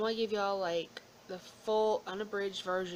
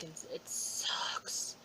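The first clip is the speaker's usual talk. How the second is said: slow, soft whisper; whispered aside; 4 semitones lower, 4 dB quieter, much slower than usual. Real, not acted. Grumbling about asthma to viewers in a whisper. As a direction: let it slip out under the breath.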